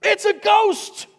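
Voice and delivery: high, slow, loud frightened shriek; scared disciple. Retold or imitated. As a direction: squeal it like a spooked disciple. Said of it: "one of the guys in the group goes".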